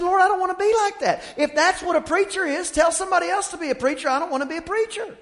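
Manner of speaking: put-on high, loud, fast young protest; mock protest to God. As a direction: beg off the calling in a rush. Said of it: "I said".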